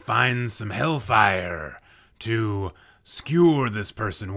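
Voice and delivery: loud, booming hellfire showman; hellish announcer. Acted. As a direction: boom it like a demon ringmaster. Hyped infernal roast intro.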